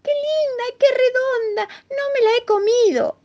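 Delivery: very high, squealing little mouse; overjoyed. Acted. Mouse shows his friends the whole moon, overjoyed. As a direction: squeal with joy and relief. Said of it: "Estaba contentísimo".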